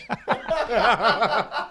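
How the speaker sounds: high loud burst of laughter. Real, not acted. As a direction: let out a big laugh. Laughing at the story.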